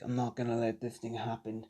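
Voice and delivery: soft, low-key vow; quiet resolve. Acted. Narrator voices injured Deku vowing to change things, quietly resolved. Acting Deku's private resolve.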